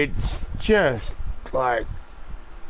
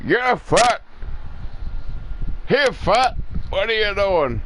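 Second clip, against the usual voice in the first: mock Joe Biden; 8 semitones higher than usual. Riffing on Biden, a hammed-up old politician jab. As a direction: bark it like a confused old statesman. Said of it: "I'm sounding like fucking Joe Biden".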